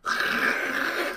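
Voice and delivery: rasping spray noise; imitated splatter of spit in rushing air; spit blown back. Jumper's spit flying up his face, imitated splatter noise. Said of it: "it just goes".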